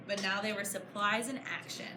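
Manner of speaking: plain reading voice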